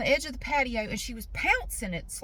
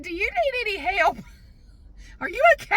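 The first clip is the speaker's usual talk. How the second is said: high, laughing concerned question; amused young man; 8 semitones higher, 4 dB louder than usual. Retold or imitated. Neighbor's grandson calling over to her, laughing question.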